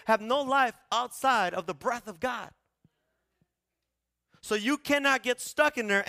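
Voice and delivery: loud, high-pitched preacher voicing God's authority; full-force preaching. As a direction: thunder it out as God laying down authority.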